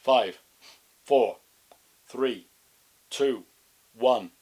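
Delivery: loud, steady countdown; loud ostrich countdown. Acted. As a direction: call out the countdown. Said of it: "The ostrich counted down".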